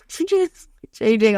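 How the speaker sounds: slow, drawn-out mechanical creak; a hammed-up machine groan; transformer noise. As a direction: imitate a robot changing shape, slowly. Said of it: "she said I sounded like a transformer".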